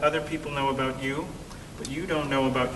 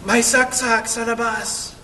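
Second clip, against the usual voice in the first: high, urgent alarm call; mock panic in a raised voice; alarmed neighbor; 9 semitones higher, 5 dB louder than usual. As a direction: blurt out the bad news in a high voice. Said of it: "And she says".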